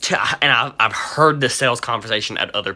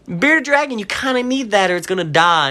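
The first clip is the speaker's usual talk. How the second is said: insistent warning; 7 semitones higher than usual. Retold or imitated. Insisting to the customer.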